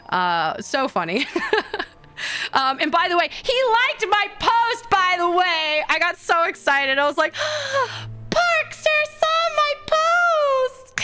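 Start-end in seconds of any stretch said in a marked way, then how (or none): 3.3-5.8 high, slow giddy announcement; can't hold in the excitement; excited. Real, not acted. 7.4-8.2 high sharp fangirl gasp; excited gasp. Retold or imitated. 8.3-11.0 high, very slow squealed line; fangirl squeal. Retold or imitated.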